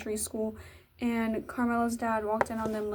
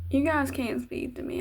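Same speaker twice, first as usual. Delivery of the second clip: ordinary talk, nothing marked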